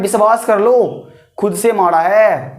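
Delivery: slowed, "लो" and "से" pressed hard; slow firm stress on "से" in "खुद से मारा है"; emphatic insistence. Shown as a demonstration. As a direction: say it slowly so they believe you. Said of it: "जोर दे करके बोल रहे हैं".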